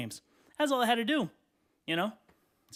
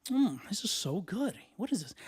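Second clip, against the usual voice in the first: acting intrigued; 4 semitones higher, 4 dB quieter than usual. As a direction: savor it and pretend to be fascinated. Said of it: "I always act intrigued".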